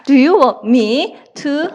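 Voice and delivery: very slow, word by word; slow dictation. Shown as a demonstration. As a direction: spell it out slowly for students to write. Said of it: "그냥 쓰시면 되죠".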